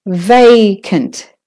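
"vacant" with a long first syllable VAY; stress on VA. Shown as a demonstration. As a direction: lean on the va in "vacant". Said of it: "is the stressed syllable".

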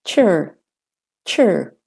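"chur" with an American r-coloured ending. Shown as a demonstration. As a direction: sound the r in "chur", American style. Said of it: "doing the American accent".

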